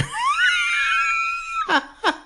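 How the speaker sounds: long, very high squealing laugh; high-pitched laughing fit; helpless laughter. Real, not acted. Cracks up at brother's crude saying.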